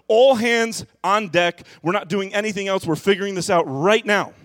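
hammed-up crisis mode; mock panic. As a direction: play the frantic panic. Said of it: "and it's like".

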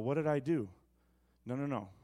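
normal talking voice

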